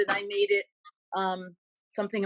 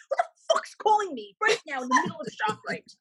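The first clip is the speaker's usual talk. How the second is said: much higher exasperated outburst; pissed off; 12 semitones higher than usual. Retold or imitated. Stuck in the ShopRite line, phone rings: much higher furious outburst. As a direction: vent like a fed-up shopper in a long line. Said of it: "But I was so pissed off".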